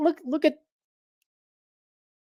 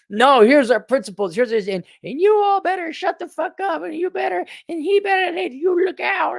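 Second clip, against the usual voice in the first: mock bossy spokeswoman; 5 dB louder than usual. Mocking a women's action committee.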